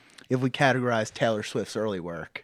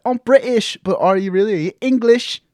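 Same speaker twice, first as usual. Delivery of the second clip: higher, louder mock posh Brit; 7 semitones higher, 9 dB louder than usual. Imitating a British man questioning an English man. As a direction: play a Brit questioning another. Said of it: "I feel like they're like".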